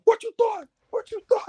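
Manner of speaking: very high squeaky mock taunt; hammed-up Braun gloating over the body. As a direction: taunt him in a cartoonish high voice. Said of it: "Braun's just like".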